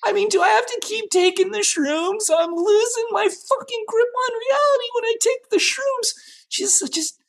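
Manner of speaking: spiralling up into a high-pitched freak-out; panicked rant. Acted. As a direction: lose your grip, voice climbing very high. Singer panics alone over the drugs.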